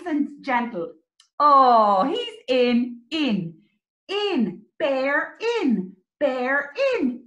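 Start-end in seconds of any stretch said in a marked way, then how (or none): none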